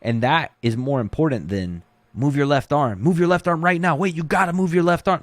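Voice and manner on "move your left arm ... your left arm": frantic coaching. Shown as a demonstration. Panicking coach screaming at athlete on bottom, frantic. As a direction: yell instructions like you're losing it. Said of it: "That just sounds frantic and weird".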